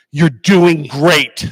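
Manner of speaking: slow, emphatic, loud blessing; heartfelt encouragement. Real, not acted. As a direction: deliver it slowly as a gift to the room. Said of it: "You wanna know why?".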